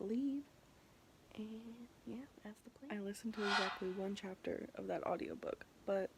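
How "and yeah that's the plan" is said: whispering. Real, not acted. Whispered late-night aside.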